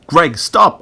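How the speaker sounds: loud, high protest; alarmed shout; urgent cry. A narrator's reading. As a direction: cry out to make him stop. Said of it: "I cried".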